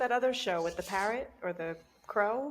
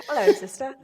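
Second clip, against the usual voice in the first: put-on "Hello, sister" in a light, higher, louder English accent; mock English accent; 4 semitones higher, 6 dB louder than usual. Sister showing how they greet each other, in a mock English accent. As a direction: chirp it in a cheeky English accent. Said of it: "with an English accent".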